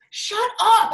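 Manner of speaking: exasperated outburst. Retold or imitated. Daughter shouts at her dad, loud exasperated shut-up. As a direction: fling it at him in disbelief.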